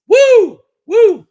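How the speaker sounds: two loud, high-pitched whoops; excited whoop. Real, not acted. Fan reliving a great watchable game, whooping loudly. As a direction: let out sharp piercing woos. Cheering the wild Jets game.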